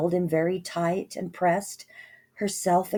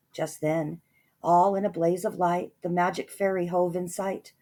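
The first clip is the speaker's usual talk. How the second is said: ordinary delivery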